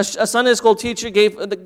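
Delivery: ordinary delivery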